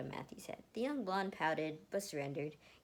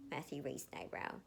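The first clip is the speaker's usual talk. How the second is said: plain reading voice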